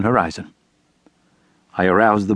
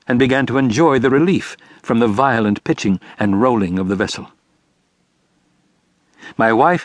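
ordinary delivery

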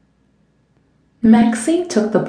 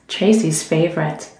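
usual speaking voice

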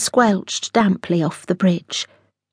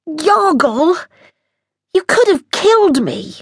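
high, loud, outraged cry; captain shouting at the alien cook; outraged yell; 10 semitones higher, 5 dB louder than usual. Acted. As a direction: yell at the cook who nearly killed you.

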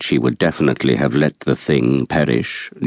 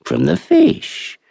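higher, slow, offhand reply; mother's dismissive answer; 11 semitones higher, much slower than usual. Acted. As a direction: drag it out as if it's obvious.

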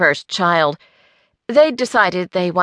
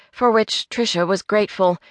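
ordinary delivery

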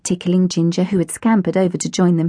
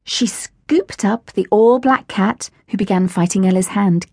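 plain reading voice

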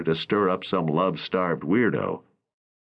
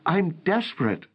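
raised, lightly voiced young actress; a worried young actress pleading, narrator lifts his pitch higher; April's voice; 11 semitones higher than usual. A narrator's reading. Narrator voicing April to Raleigh, worried and higher.

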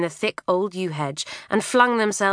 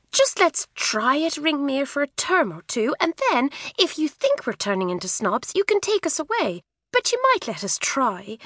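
higher, wheedling schoolgirl voice; coaxing girl; 6 semitones higher than usual. A narrator's reading. Twin coaxing her mother to let them try, wheedling. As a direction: sweet-talk Mummy into it.

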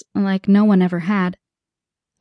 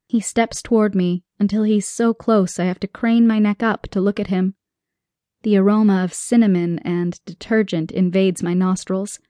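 plain reading voice

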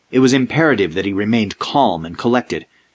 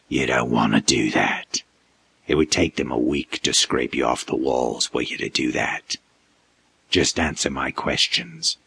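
low, whispered menacing creature; whispered monster threat; 8 semitones lower, 7 dB quieter than usual. A narrator's reading.

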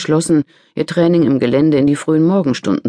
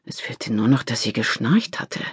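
usual speaking voice

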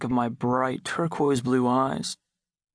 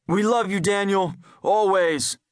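calling out; 6 semitones higher, 3 dB louder than usual. A narrator's reading. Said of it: "my father call out".

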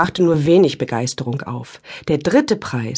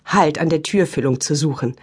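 usual speaking voice

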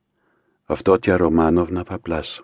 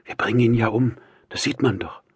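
ordinary delivery

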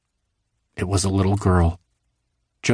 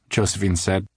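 usual speaking voice

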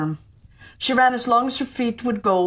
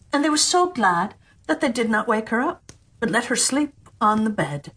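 ordinary delivery, nothing marked

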